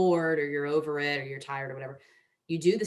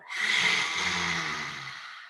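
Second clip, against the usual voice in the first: long open-mouthed huffing exhale; lion's breath exhale. Shown as a demonstration. Instructor demos the lion's breath for viewers, long huffing exhale. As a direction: let the breath roar out like a lion. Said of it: "Breathing in and out".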